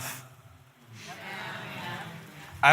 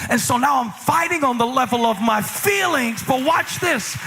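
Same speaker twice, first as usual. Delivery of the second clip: high, slow, ringing sermon climax; full-force preaching; 6 semitones higher than usual. Acted. Pastor preaching to the congregation, high and slow.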